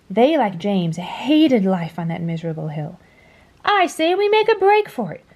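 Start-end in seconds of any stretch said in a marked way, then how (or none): 3.6-5.2 much higher, brash swaggering bug voice; boastful centipede. A narrator's reading. Boastful centipede urging the bugs to escape, high and brash. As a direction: proclaim it like a cocky ringleader. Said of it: "proclaimed the boastful centipede".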